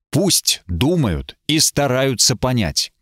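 plain reading voice

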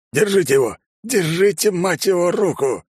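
loud high urgent shout; shouting orders over battle. A narrator's reading. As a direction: yell for help holding him. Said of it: "кричал сержант". (крик сержанта)

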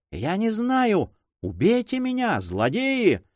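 a high, slow roar on «Я не знаю!», cry of pain. Acted. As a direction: bellow «знаю» from agony, pitched high. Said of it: "взревел пленный". (пленный ревёт от боли)

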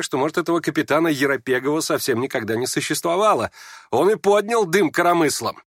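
high, lively, needling complaint; mocking tone. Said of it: "всё как будто тем же насмешливым тоном". (насмешливым тоном, на повышенных нотах)

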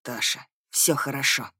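low, soft, calming voice; reassuring male character. A narrator's reading. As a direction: soothe her quietly from down low. Wounded prince soothes frightened heroine. (тихо, низко, успокаивающе)

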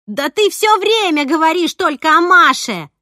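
high, loud jealous yell. A narrator's reading. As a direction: scream it at him. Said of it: "заорала Снежана". (заорала, громко)

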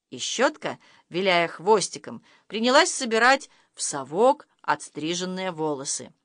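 ordinary delivery, nothing marked

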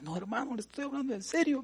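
high, tearful mock plea of a young man; retold crying plea. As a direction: imitate him pleading through tears. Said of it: "él se puso a llorar".

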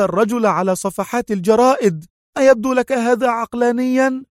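mild indignant sharpness throughout; sharp exclaim. A narrator's reading. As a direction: cut at him, incredulous. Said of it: "هتفت في حدة".

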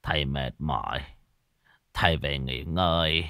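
put-on low, soft, worn-out old father; spent, resigned murmur; weary and dejected. A narrator's reading. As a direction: wave them off in a tired low voice. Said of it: "Lão chán nản phất tay".

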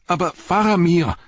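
high, loud desperate cry; screaming. Acted. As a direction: cry out for Faramir in panic. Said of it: "schrie Pippin". (hoher, verzweifelter Schrei)

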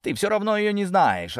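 high, curt brush-off. A narrator's reading. Ginzburg refusing to discuss Maria, curt. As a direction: cut her question short. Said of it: "отрезал Гинзбург".

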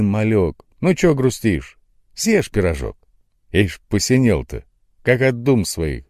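loud Odessa market woman; Odessa accent. A narrator's reading. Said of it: "с одесским акцентом кричала".

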